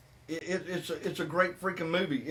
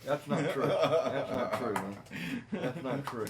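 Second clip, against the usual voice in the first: denying through laughter; host denying the co-host's tease, laughing; laughing denial; 2 semitones lower than usual. Real, not acted. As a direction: laugh while you deny it. Denies, laughing at the teasing.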